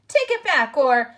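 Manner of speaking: high loud threat; shout. A narrator's reading. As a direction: yell it as a threat. Dwarf threatens, cut off.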